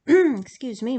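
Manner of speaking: throat catching, voice breaks; voice cracking. Real, not acted. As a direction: clear the throat and speak through the crack. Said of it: "my voice just kinda lost it".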